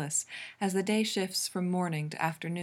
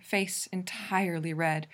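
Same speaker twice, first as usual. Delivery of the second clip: ordinary delivery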